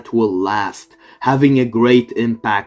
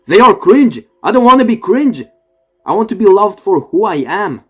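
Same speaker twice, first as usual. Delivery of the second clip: high-pitched, indignant outburst; mock indignant protest; 9 semitones higher, 4 dB louder, faster than usual. Past self refusing to be cringe, high indignant protest. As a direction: protest in a raised, worked-up pitch.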